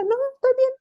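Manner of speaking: high, loud mock denial, clearly upset; an overbright I'm fine that says the opposite; insisting she's fine. Wife tells husband she's fine while angry, in a high loud denial.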